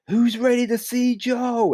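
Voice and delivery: high, slow crowd hype; hyping the crowd. Retold or imitated. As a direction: call out to rev up the crowd.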